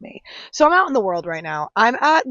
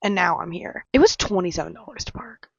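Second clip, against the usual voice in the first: usual speaking voice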